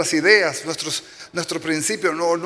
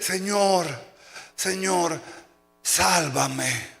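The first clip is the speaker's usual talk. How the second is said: pleading prayer; 3 dB quieter, much slower than usual. Acted. Hombre ora colgado del árbol.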